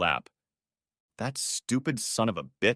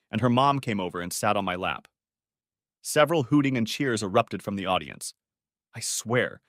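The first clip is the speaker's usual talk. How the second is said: usual speaking voice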